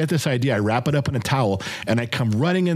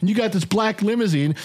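mock angry rant; 8 semitones higher than usual. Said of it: "I'm like".

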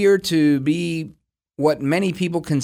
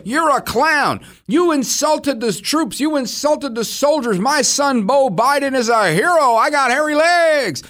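higher, slower mock old-politician rant; mock Biden impression; 6 semitones higher than usual. Mocking Biden dodging any debate question, higher voice. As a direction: lampoon him blurting out nonsense.

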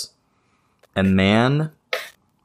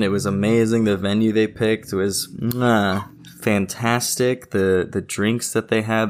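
usual speaking voice

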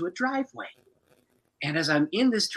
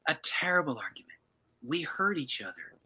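hushed confession; 6 dB quieter than usual. Retold or imitated. Wife confessing a quarrel, retold.

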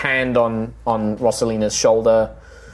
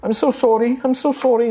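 high, tearful halfling woman, breaking over the words; tearful apology; 13 semitones higher than usual. Acted. Halfling stablehand apologising to the heroes, tearful and high. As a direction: pitch it way up and plead through the tears. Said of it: "keeps kind of looking".

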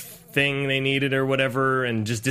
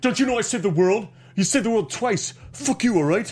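raised, petulant hero whine; whiny movie-star brag; Shia LaBeouf impression; 5 semitones higher than usual. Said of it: "He's like".

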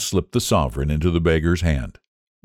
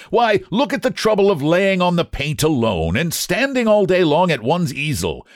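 high, animated self-defence; indignant protest; 10 semitones higher than usual. A narrator's reading. Painter defending his labour to his friend, high indignant protest. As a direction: argue your case in a raised pitch.

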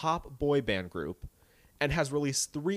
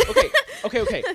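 high embarrassed laugh through words; 8 dB louder, much faster than usual. Real, not acted. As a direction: laugh it off, squealing. Just discredited herself, laughing.

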